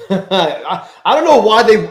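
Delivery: bursting out laughing mid-sentence; words spilling out on a laugh. Real, not acted. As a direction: talk through a real laugh. Laughs reading chat.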